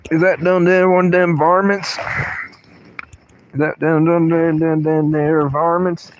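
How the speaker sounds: play-acting an old-timey hillbilly; mock hillbilly. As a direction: put on a backwoods varmint-hunter accent. Mock hillbilly phrase said repeatedly.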